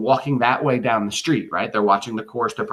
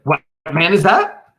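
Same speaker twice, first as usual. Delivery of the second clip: higher, louder, lightly acted challenge; a hint of a commanding ruler; imperious Caesar; 5 semitones higher, 5 dB louder than usual. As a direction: demand it as Caesar, rising in pitch. Acting out Caesar's lines from play.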